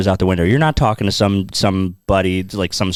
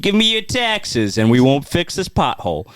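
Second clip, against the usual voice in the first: put-on Chicago accent; 6 semitones higher than usual. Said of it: "a Chicago accent is just like".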